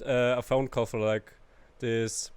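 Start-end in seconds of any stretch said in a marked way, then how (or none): none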